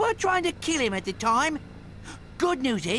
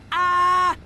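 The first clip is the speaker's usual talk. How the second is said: startled cry; 4 semitones higher, 8 dB louder than usual. Acted. Slag startled on display.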